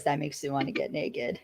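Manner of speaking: low, soft and suggestive; sultry low voice. Retold or imitated. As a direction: purr it suggestively. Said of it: "And he says".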